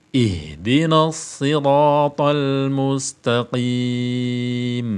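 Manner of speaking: slow, drawn-out chanted recitation; slow Quran recitation. Shown as a demonstration. Teacher recites the verse slowly for students to copy.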